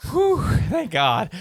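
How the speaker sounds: high, drawn-out mock sigh of relief; imitated relieved reaction. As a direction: act out a listener's huge relief. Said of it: "she reacts to things".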